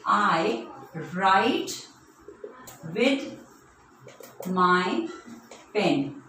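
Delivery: each word alone, long gaps between; word-by-word dictation. Shown as a demonstration. Teacher giving children a pen sentence, slow word-by-word dictation. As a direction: dictate it slowly, word after word. Writing example sentence on board.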